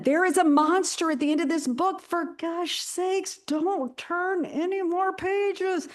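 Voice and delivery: high, slow pleading Muppet monster; Grover voice. Retold or imitated. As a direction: beg them to stop, high and drawn out. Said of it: "You know, in the Grover voice".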